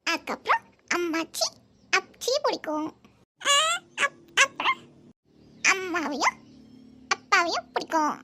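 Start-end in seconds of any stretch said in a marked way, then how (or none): 3.4-4.8 put-on high stumbling little girl voice; child voice. Niece explaining dust in her eye, high childish. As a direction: stammer like a small child.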